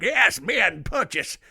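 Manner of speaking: hammed-up Southern officer; bad Southern accent. General addressing his troops under fire, mock Southern accent. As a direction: put on a shaky attempt at a Southern accent. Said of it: "probably doesn't have a Southern accent".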